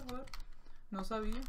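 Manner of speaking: usual speaking voice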